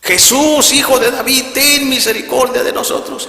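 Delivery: full-force, very high pleading shout; grito más fuerte. Retold or imitated. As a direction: shout the plea at the top of your voice. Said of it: "otra vez más fuerte". (súplica a gritos, con toda la fuerza)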